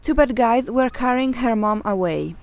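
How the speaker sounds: plain reading voice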